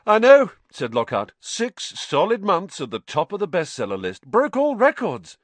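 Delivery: smug showman boasting of his bestseller; Lockhart's voice. Acted.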